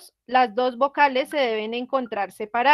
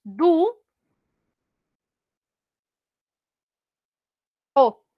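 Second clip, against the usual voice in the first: Du and o as two separate beats with a long pause between; split into syllables; 3 semitones higher, 3 dB louder than usual. Shown as a demonstration. Teacher showing the class how Dúo splits into two syllables. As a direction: break Dúo into two syllables, slowly and clearly. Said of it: "se separa en dos sílabas".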